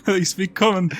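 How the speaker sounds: lightly voiced posh orc; a hint of a plummy orc accent. Orc answers the adventurer in lightly posh broken common. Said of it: "that sounded posh".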